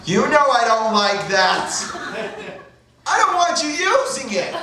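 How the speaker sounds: high, slow, whining scold; disapproving mother. Retold or imitated. Retold mother scolding her son.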